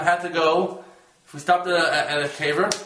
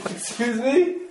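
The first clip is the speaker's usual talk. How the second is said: shocked excuse me; 7 semitones higher, slower than usual. Retold or imitated. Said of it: "So I'm like".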